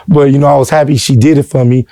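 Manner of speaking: ordinary delivery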